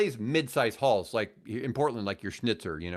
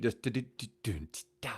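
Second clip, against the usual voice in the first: soft mouthed drum riff du-du-du-dun-ti-da; vocal drum beat; 5 dB quieter than usual. Retold or imitated. To friends, imitating the Superstition drum intro softly. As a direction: tap out the drum intro with your voice. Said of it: "That intro starts with a drum beat".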